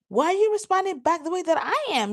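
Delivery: high-pitched hurt protest; acted indignation. Feeling rejected by a loved one, high indignant protest. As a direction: send the question way up, incredulous. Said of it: "then you feel rejected".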